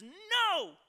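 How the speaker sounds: put-on high, loud child's protest; toddler refusing. Two-year-old refusing the car seat, high shrill no. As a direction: squeal a toddler's flat refusal.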